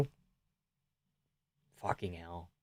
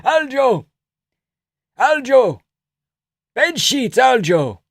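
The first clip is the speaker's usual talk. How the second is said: put-on high loud accented insistence; eager high Georgian voice; Merab impression; 13 semitones higher, 4 dB louder than usual. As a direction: keep adding one more thing, high and loud. Merab voiced random advice.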